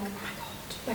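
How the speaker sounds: a hushed, breathless oh my God; whispered awe. Retold or imitated. Excited actors whispering on set.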